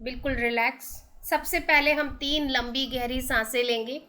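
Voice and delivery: slow, drawn-out breathing cues with long pauses; guided meditation. Acted. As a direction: lead listeners unhurriedly through each deep breath. (धीमी, ठहर-ठहर कर निर्देशित ध्यान की आवाज़)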